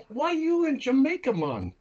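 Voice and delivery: put-on playful Jamaican accent, disbelief in the pitch; incredulous Jamaican hustler. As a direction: imitate a Jamaican who can't believe you don't smoke. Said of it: "He goes".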